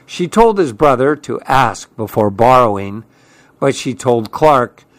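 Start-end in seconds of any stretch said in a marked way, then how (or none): none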